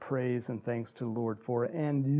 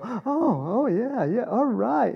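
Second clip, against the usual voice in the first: relieved policemen; 8 semitones higher, slower than usual. Retold or imitated. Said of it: "they just kinda go".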